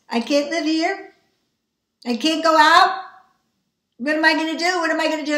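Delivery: high, loud panicked pleading; desperate high panic; panicked cry. Retold or imitated. Panicked thoughts retold.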